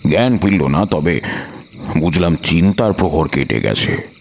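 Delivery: ordinary delivery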